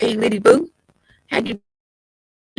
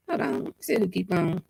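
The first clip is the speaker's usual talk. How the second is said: ordinary talk, nothing marked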